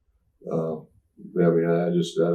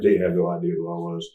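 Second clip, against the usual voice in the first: usual speaking voice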